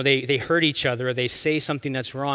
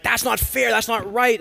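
higher, indignant outburst; angry protest; 9 semitones higher, 5 dB louder than usual. Retold or imitated. David hears of the stolen sheep, angrily. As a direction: act out mounting outrage as David. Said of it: "getting more and more angry".

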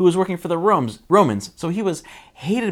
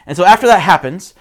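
ordinary talk, nothing marked

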